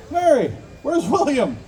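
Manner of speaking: high, drawn-out mock demand; acted stern questioning. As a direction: call out the question slowly, pitch swooping up. Priest acted, questioning the wife.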